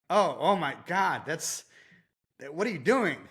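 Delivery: retold horror, pitch climbing sharply; appalled reaction. Trainer to client scooping poop by hand, appalled. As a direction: act out an appalled double-take. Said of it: "And I react".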